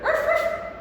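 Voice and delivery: high yappy puppet bark; dog bark. Pretend. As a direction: bark like a small cartoon dog.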